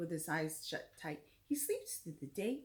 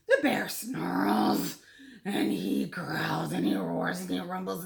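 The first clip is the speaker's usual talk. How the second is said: growling bear; 9 dB louder than usual. A narrator's reading. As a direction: rumble each word like a grumpy bear. Angry bear wakes up.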